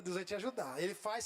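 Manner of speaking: normal talking voice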